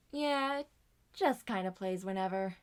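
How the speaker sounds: normal talking voice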